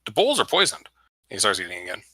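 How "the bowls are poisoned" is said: put-on very high-pitched, accented creature voice; Tabaxi character voice. Tabaxi defending the food to the party, high character voice. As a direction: pitch it right up as the protesting cat-man.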